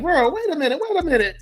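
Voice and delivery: high, disbelieving "wait a minute"; incredulous. Retold or imitated. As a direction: stop them short in disbelief, pitched high. Incredulous retort to gossiping person.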